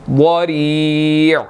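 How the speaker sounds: वरी' drawn out with a thick d; वरी', heavy. Shown as a demonstration. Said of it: "वाद जो है वो यहाँ पर मोटा है".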